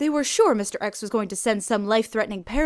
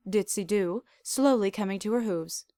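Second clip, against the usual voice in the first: ordinary delivery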